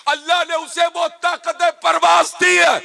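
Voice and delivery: shouted, high sermon climax; preaching at full force. Acted. Preacher dramatizing the revelation to listeners, shouted at full force. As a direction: thunder it out to the congregation. Preacher describes Jibril's might.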